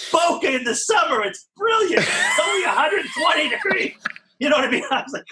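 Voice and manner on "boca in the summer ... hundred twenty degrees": gushing salesman. Retold or imitated. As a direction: ham up the sleazy timeshare seller, shouting with glee. Mocking a pushy timeshare salesman.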